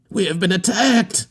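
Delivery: high, strained scream in a put-on voice; a frightened manager yelling from shore. A narrator's reading. Manager screaming the news to the arriving captain. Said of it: "screamed the manager".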